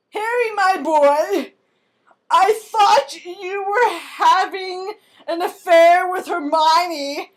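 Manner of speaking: put-on slow, loud, high theatrical old wizard; dramatic Dumbledore. Dumbledore's tragic revelation to Harry, slow building shout. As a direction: build to an anguished cry.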